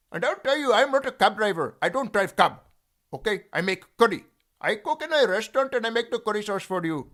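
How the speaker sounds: high, loud Indian restaurant-cook voice; Indian accent. Acted. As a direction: belt it out as a pushy Indian curry cook. Accent bit before naming other voices.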